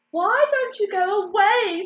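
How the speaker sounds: high, loud squawk. A narrator's reading. Little ducklings to the ugly one, squawking. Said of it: "squawked the other little ducklings".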